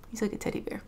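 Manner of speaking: soft whisper while holding the cat. Real, not acted. Cuddling her heavy cat, tender whisper. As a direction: breathe it out over the purring cat.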